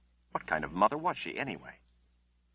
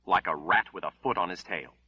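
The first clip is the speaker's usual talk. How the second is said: plain reading voice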